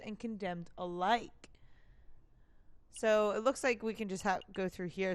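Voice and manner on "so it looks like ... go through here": usual speaking voice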